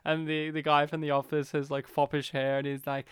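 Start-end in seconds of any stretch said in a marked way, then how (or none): none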